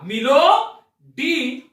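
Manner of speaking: "मिलो डी हम लोगों से" drawn out slowly, each word apart; slow, deliberate. Shown as a demonstration. Teacher slowly repeating the pun line for students. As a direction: spell it out slowly for the class. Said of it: "ध्यान फरमाइएगा".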